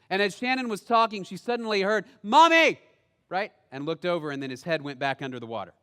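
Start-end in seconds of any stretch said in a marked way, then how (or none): 2.2-2.8 put-on loud, high cry of a drowning child; child calling out. Son in the pool screaming for his mother, a high loud call. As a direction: yell for mommy from the water.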